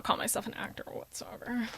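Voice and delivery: whispered ASMR voice. Acted. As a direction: breathe it like an ASMR video. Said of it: "I love your A S M R voice".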